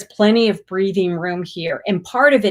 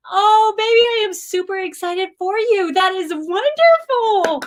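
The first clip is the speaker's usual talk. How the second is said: gushing joy; 12 semitones higher than usual. Real, not acted. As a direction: pour out delight for her. Gushing congratulations to a viewer.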